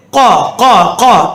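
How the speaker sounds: கா as a short rounded 'qo'; heavy Arabic q on each கா; throat q drill. Shown as a demonstration. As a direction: repeat கா from the back of the throat. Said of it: "என்று practice பண்ணணும்".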